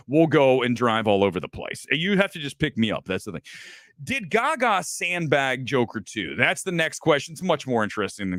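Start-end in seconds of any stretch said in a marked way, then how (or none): none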